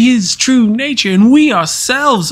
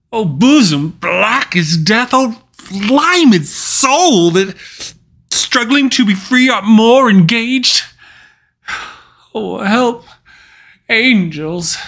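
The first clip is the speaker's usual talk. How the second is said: anguished outcry; 4 semitones higher than usual. Acted. A guilty sinner cries to angels for help, anguished outcry. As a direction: wail out the struggle of a trapped soul.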